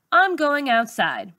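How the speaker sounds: plain reading voice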